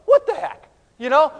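high, loud, incredulous; baffled outburst. Acted. Disciples turn around to each other, baffled outburst. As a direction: blurt it out in mock bafflement.

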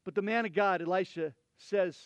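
ordinary talk, nothing marked

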